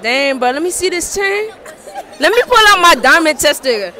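ordinary talk

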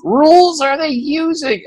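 imitating an indignant grumble, pitched high; exasperated Tom. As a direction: whine like a fed-up editor, way up high. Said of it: "he goes".

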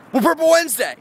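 high, loud burst of excitement; excited announcement. Real, not acted. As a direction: announce it with a delighted shout.

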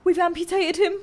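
high panicked shriek; horrified squeal at the screen; shocked shriek. Real, not acted.

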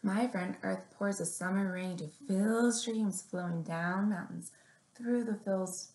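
gentle picture-book read-aloud; story reading. Acted. Librarian reading a picture book to little kids, gently.

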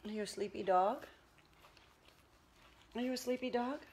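quiet tender murmur to the dog; whisper-coo to a sleepy puppy; soft pet talk. Real, not acted. Owner snuggling her tired dog, quiet tender pet talk.

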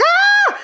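very high, loud yelp of emotion; sudden overwhelmed cry. Real, not acted. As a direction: let out a high cry of feeling. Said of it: "I'm like".

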